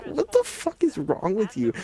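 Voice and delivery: high, indignant what the fuck; outraged disbelief. Retold or imitated. Teen answering the accusing wife, outraged.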